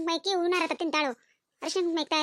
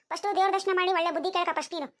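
ordinary talk

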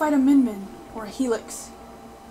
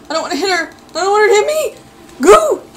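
high yell building louder; rising panic; 7 semitones higher, 10 dB louder than usual. Real, not acted. Player afraid of hitting a teammate, rising panic. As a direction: build from worried whine to loud shout. Teammates can hit each other.

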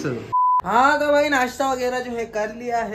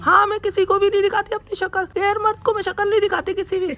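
mimicking wife; 11 semitones higher than usual. Husband mimics his wife to the camera, high falsetto. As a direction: squeak it out like a pouty wife. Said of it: "mimicry कर रहा हूँ मैं".

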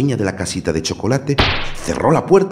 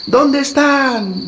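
calling out; 5 dB louder, much slower than usual. A narrator's reading.

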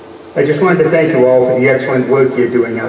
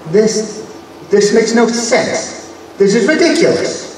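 exasperated; 8 semitones higher than usual. Acted. Professor exasperated at anomaly.

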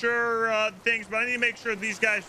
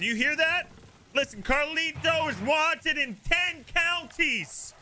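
accusing shout; 9 semitones higher than usual. Acted. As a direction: proclaim his crimes to the crowd. Cop accusing suspect in front of crowd.

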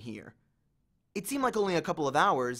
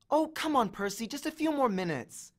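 put-on higher pleading girl's voice; whiny girl; 8 semitones higher than usual. A narrator's reading. Annabeth begging Percy for more playing time. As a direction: whine to keep playing.

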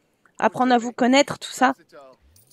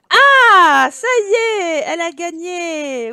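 loud, high cry of victory, then easing; triumphant cheer; 4 semitones higher, 7 dB louder, much slower than usual. Real, not acted. Player celebrating Esther's finished book, triumphant cheer. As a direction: burst out cheering, stretching the words.

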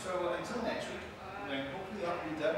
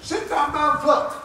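shouted, higher and louder; loud command; 11 semitones higher, 12 dB louder, slower than usual. Real, not acted. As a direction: bark the order laughing. Ordering others on set.

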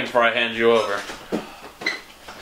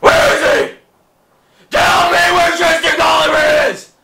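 higher, louder, yelling demands; bad cop yelling; angry interrogation shout; 5 dB louder than usual.

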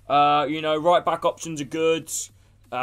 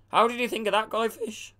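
voice crack; 6 semitones higher than usual. Real, not acted. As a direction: voice cracking on a high note. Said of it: "Ignore my voice crack".

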